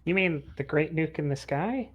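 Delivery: usual speaking voice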